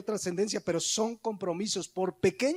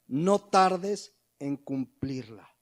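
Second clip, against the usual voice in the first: slow verse quote; 4 semitones lower, much slower than usual. Acted. Preacher quoting verse slowly.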